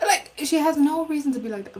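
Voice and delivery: loud and high, fed up; exasperated outburst. Real, not acted. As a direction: blurt it out in disbelief. Venting about rude shopper.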